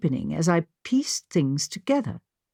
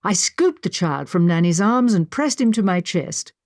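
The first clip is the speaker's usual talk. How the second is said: ordinary delivery, nothing marked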